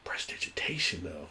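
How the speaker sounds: "Prestidigitation" breathed as a soft unvoiced whisper; reverent whisper. DM savouring his favourite spell to a fellow DM, whispered.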